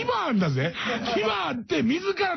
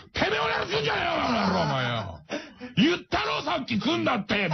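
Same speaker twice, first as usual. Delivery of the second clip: fast, rough thug-like snarl; angry beluga; 6 semitones higher than usual. Retold or imitated. Beluga cursing Tashiro to go away, fast thuggish snarl. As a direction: bark at him like a furious punk. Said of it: "みたいな".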